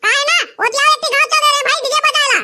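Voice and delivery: ऊँची, बदली हुई फ़ोन वाली आवाज़ में डीजे वाला; फ़ोन पर बेफ़िक्र डीजे वाला बनकर बोलो; डीजे वाले की आवाज़. Acted. DJ operator on the phone. (दूल्हे के दोस्त से फ़ोन पर बात करता डीजे वाला, ऊँची आवाज़ में)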